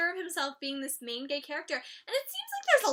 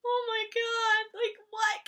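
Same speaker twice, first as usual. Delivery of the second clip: high, slow disbelieving cry; incredulous; 7 semitones higher, 3 dB louder, slower than usual. Real, not acted. Vlogger stunned by the shirtless masked video, incredulous. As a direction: gasp in disbelief.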